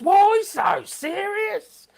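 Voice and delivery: hammed-up comic-book villain line; Joker. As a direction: stretch each word like a grinning madman. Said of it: "Yeah, old Joker".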